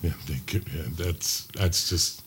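breathy low whisper. Real, not acted. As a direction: drop to a hushed whisper. Whispered aside.

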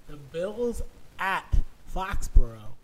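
Foxborough in a Boston accent. Real, not acted. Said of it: "my Boston accent came up there".

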